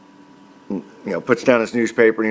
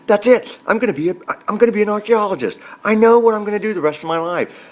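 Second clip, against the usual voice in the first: put-on high, fast, thrilled child's voice; a kid who just found his life's calling; excited kid epiphany; 9 semitones higher than usual. Boy announcing his discovery, high and thrilled.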